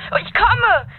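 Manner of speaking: ordinary talk, nothing marked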